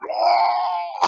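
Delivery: loud, high retching roar; imitated vomiting. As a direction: heave out a long puking howl. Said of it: "start throwing up".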